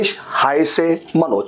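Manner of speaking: Ich heiße Manoj said slowly, heiße as "hai-se"; slow model sentence. Shown as a demonstration. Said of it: "That's the pronunciation".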